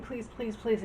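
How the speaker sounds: soft, pleading please, please; mock begging. Suitor begging the queen, soft mock pleading. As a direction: beg quietly like a suitor. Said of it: "like, begging".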